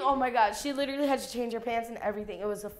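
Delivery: ordinary talk, nothing marked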